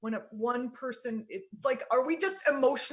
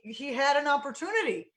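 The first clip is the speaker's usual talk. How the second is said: normal talking voice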